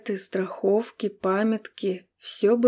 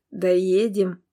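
usual speaking voice

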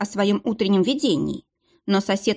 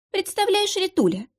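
fake sympathy; 12 semitones higher than usual. A narrator's reading. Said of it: "фальшиво-сочувственным голосом".

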